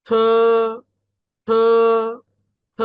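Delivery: long aspirated থ; Pinyin t as থ. Shown as a demonstration. Teacher models t for the class, drawn-out breath-released থ. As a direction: puff air into a held থ. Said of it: "থ এর মতো".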